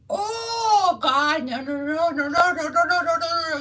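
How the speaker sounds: high, loud, wailing mock protest; hammed-up crying official scolding; mock whiny bureaucrat. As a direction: parody her shrill outraged denial. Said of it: "bitch that's running The state".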